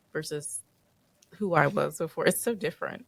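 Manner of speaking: normal talking voice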